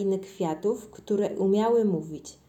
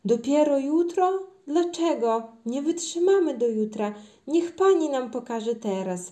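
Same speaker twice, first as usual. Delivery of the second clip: high, loud, impatient protest; pleading children; 6 semitones higher, 5 dB louder than usual. A narrator's reading. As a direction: beg like children who can't wait. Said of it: "prosiły dzieci". (błagalne, niecierpliwe prośby dzieci)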